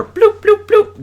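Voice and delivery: mimicking a comic teleport sound, high and playful; teleport sound effect. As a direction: pop each bloop up high.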